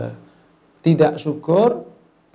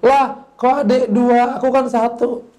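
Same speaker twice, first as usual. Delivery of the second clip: put-on protesting older sibling; 8 semitones higher, 4 dB louder than usual. Older sibling objects to younger one's second dish, high-pitched protest. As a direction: object as the slighted big sibling. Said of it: "kakaknya bilang".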